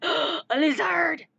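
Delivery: loud, drawn-out exclamation; gasp, then cry it out slowly; retold thrilled discovery; excited gasp.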